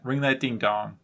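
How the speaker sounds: ordinary talk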